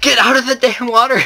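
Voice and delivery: high, loud exasperated shout; frustrated yell. Real, not acted. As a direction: yell at the enemy in the water. Yelling at enemy in game.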